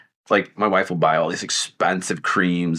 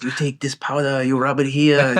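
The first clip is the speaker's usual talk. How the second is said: lightly voiced old Chinese lady; playful mock-accent shopkeeper; imitating a Chinese accent; 4 semitones higher than usual. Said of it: "she goes".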